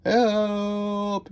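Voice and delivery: high, long-held cry of help; mock yell for help. A narrator's reading. Buried judges yelling from under the sand, high drawn-out yell. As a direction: call out high, stretching the word. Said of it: "yelled the judges".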